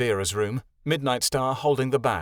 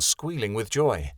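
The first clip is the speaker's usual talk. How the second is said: ordinary delivery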